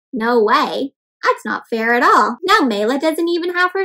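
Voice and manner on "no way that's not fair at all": ordinary delivery, nothing marked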